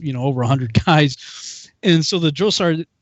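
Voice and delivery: ordinary talk, nothing marked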